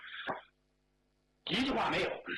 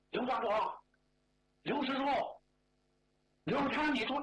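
calling out; 6 semitones higher than usual. Acted. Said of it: "我就这么喊".

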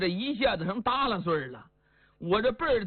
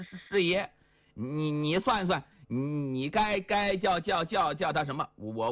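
stammering Li Yuanba; 2 semitones lower than usual. Acted. Li Yuanba stammering, pitched lower.

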